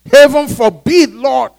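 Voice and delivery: loud, high, drawn-out protest; Peter's reprimand. Acted. Peter rebuking Jesus for his words, loud reprimand. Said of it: "reprimand him for saying such things".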